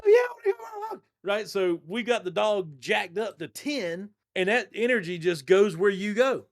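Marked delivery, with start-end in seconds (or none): 0.0-1.0 high, fast, shouted excited dog voice. Retold or imitated. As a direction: yelp it out like a dog itching for a walk. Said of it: "And the dog's like".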